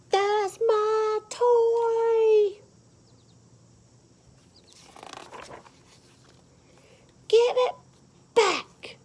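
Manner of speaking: put-on high, loud, slow furious child shout; angry child yell. Boy whose toy was taken yells at the girl. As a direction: scream it like a kid whose toy was snatched.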